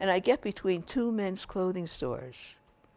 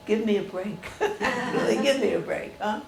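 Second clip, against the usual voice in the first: laughing, louder give me a break; laughing exasperation; 3 semitones higher, 6 dB louder than usual. Real, not acted. Telling listeners of a second brake dream, laughing. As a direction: laugh it out in disbelief. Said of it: "laughs heard".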